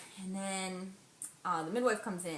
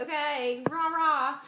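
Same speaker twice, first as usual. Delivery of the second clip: higher and louder mock cheerleading rah rah; mock the cheering coach with a sneer; sarcastic cheer; 6 semitones higher, 7 dB louder, slower than usual. Mocking the midwife's cheering while pushing, sarcastic rah rah. I was so pissed.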